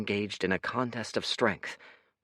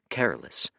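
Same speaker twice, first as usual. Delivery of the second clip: ordinary delivery, nothing marked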